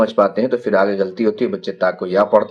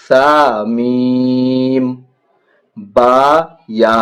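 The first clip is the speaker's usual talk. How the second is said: each letter name stretched long and sung out; slow letter drill; 4 dB louder, much slower than usual. Shown as a demonstration. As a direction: chant the alphabet slowly for children. Drawn-out letter recitation drill.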